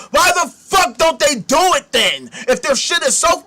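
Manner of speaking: real exasperated yell at them; angry shout. Real, not acted. Ranting at Christians who won't help, real angry shout.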